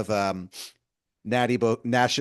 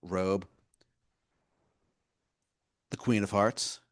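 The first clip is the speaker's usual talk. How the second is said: ordinary delivery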